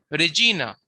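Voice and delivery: "Regina" said re-JEE-na, middle stressed; long, stressed "gi" in "Regina"; stress on second-last syllable. Shown as a demonstration. Teacher showing where the accent is, "Regina" stressed on GI. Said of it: "the accent is on G".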